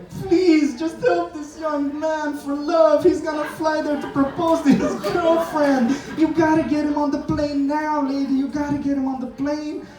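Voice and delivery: put-on very high, slow, begging old lady voice; high tearful granny plea; pleading old lady. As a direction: beg the agent like a tearful grandma. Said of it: "she was like".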